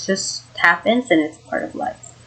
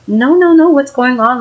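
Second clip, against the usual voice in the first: higher, louder rush of no's; retold alarm; 6 semitones higher, 6 dB louder than usual. Mom on the phone to her child, retold alarm. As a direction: imitate a worried interruption.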